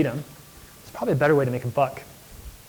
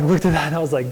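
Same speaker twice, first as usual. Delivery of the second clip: normal talking voice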